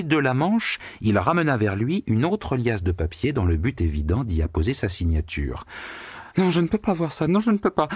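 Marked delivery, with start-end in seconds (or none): 6.4-7.9 high, distraught outcry; cry. A narrator's reading. Secretary crying out as she runs from the office, high. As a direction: cry out and flee. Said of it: "cria Anna Richardovna".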